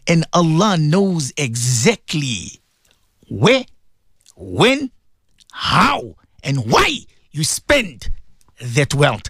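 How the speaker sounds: slow, low, weighted words; slow solemn stress. Real, not acted. Preacher telling listeners Allah knows every gift, slow and low. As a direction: let each word land slowly.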